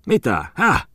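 high-pitched startled 'huh'; startled question. Acted. Muttinen asks, startled.

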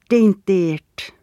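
ordinary delivery, nothing marked

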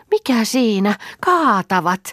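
worried cry; alarmed. Acted. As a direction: startle as the boat tips. Reeta alarmed boat tipping.

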